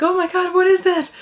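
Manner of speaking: very high, alarmed squeal of a question; retold panic. Camper reliving seeing her tent light up, mock panic.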